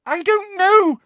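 very high, loud panicked voice; terrified squeal. A narrator's reading. Girl answers roaring giant, terrified squeal. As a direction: squeal it in terror. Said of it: "squealed Hermione".

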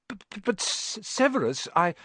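a timid professor tripping over each word; stuttering Quirrell. Acted. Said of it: "he was stuttering worse than ever".

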